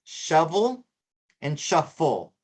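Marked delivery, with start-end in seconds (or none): none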